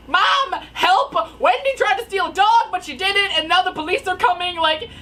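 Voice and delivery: high, loud plea for rescue; frantic retold cry for help; mock panic. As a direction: beg mom for help, hammed-up.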